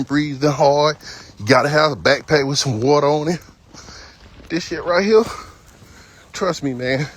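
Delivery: ordinary talk, nothing marked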